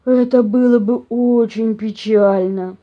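slow, loud, heavy bear lament; sighing bear. A narrator's reading. Papa bear regretting the mice's fate, sighing. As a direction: sigh it out ponderously. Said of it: "вздохнул папаша Бамсе".

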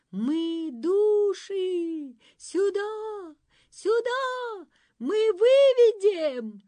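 high, drawn-out eerie call; ghostly voices. Acted. Spirits luring the travellers away. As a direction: lure them in like spirits.

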